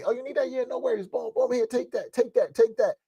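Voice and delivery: high, excited handing out; eager giving. Retold or imitated. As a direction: pitch it way up, thrilled to give. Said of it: "being able to give my family".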